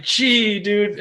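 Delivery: high, loud put-upon groan; mock whine. Answering his co's teasing, a high mock whine. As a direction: whine it like a sore loser.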